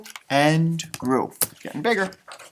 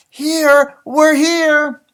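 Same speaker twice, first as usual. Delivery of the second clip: higher, louder calling penguin voice; trumpeting penguin call; 8 semitones higher, 5 dB louder, much slower than usual. Acted. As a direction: call out across the ice like a penguin dad. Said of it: "Father Penguin trumpeted back".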